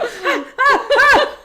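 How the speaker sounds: high, loud panting "ah"s with laughter; hammed-up scalded-mouth cries; mock pain gasps. Woman re-enacting the hot sip while he talks, comic cries. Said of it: "I'm going".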